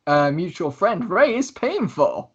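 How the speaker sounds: Lucius, grand host. Acted. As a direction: play the showy host, stretching the words. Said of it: "And he goes".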